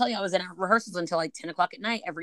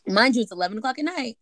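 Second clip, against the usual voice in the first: ordinary delivery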